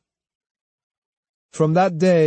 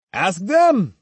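scornful retort, pitched up; sneering; 6 semitones higher, 3 dB louder than usual. Acted. Swordsman scorning the cowed students, sneering. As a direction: toss it back with a sneer. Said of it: "Kojiro sneered".

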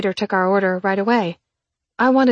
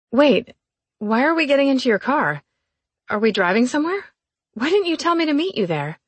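surprised; 6 semitones higher than usual. Acted. Surprised questions to Graham.

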